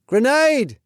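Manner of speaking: high-pitched alarmed shout; screamed warning. A narrator's reading. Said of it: "I heard this trainee scream".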